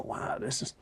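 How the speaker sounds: hushed, breathless wonder on set; soft awed aside to yourself; awed whisper. Retold or imitated. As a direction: whisper it as if stunned by the scale. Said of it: "and you just go".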